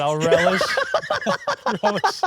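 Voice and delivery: squealing laughter around the pun word; high giggling fit. Real, not acted. Host cracking up at the co-host's pun, high squealing laughter. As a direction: laugh helplessly, high and loud.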